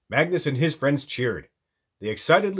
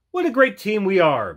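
high, beaming pride; proud; 8 semitones higher, 5 dB louder than usual. A narrator's reading. Magnus to the team after building, high proud voice. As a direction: say it with proud joy. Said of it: "Magnus said proudly".

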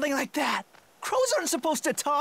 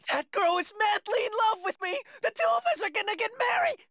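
ordinary talk

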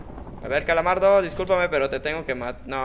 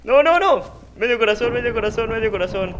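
higher and louder, fading; alarmed cry; 7 semitones higher, 6 dB louder than usual. Real, not acted. Gamer down to half a heart, alarmed cry. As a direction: call it out in alarm.

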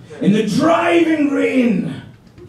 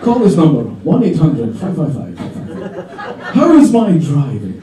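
mock ad slogan; 7 semitones lower than usual. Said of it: "sticker on the back saying".

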